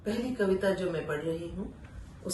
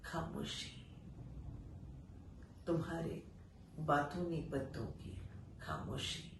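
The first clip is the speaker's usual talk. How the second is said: hushed for silence; 3 semitones lower, 10 dB quieter than usual. Acted. Silence of the leaves.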